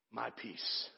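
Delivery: My peace echoed barely above a murmur; hushed reflective echo; soft repeat. Acted. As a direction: let My peace fall away quietly. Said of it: "Think about it".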